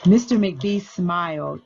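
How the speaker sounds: read-aloud picture book voice, unhurried; story narration. Acted. School librarian reading Library Lion aloud to children, gentle storytelling. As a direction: tell the story to a room of kids.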